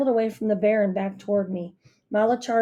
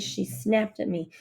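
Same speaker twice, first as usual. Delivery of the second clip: ordinary delivery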